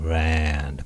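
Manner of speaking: "land" said as "rand" out of an "uu" sound; throaty English R. Shown as a demonstration. Teacher pointing out R's resonance, drawn-out. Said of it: "うーっていうこの".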